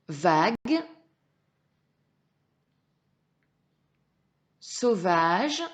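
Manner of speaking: loud, drawn-out, carefully articulated words; slow model reading. Shown as a demonstration. Teacher models words for a pupil to repeat, slow emphatic reading. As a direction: sound out each word firmly and slowly. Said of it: "tu répètes après moi".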